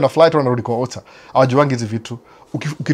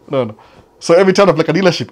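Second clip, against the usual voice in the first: ordinary delivery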